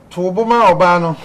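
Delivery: host voicing the caller, firm and loud; reading the caller's plea. Host reads a listener's plea to Nana, firmly. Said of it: "Ɔse, "Nana".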